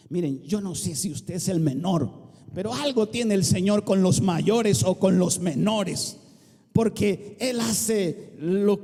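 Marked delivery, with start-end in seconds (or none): none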